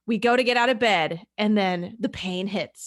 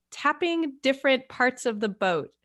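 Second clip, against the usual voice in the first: ordinary talk, nothing marked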